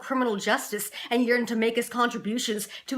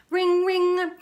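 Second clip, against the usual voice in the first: high, sustained ring-ring; two drawn-out spoken rings; phone ringing; 7 semitones higher than usual. Acted. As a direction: imitate a telephone, pitched well up. Imitates a telephone ringing.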